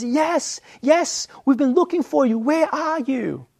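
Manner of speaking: put-on high, urgent mum answering her child; relieved mum. Mum answering her lost child, high and urgent. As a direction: mum rushing to answer, slowing on where are you. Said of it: "And your mom says".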